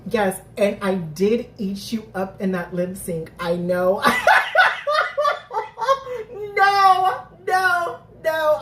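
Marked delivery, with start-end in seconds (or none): 4.1-6.5 high, loud burst of laughter; shrieking laugh. Real, not acted. Reading the subtitles, bursting into shrieking laughter. 6.5-8.6 incredulous no. Real, not acted. Laughing disbelief at subtitles.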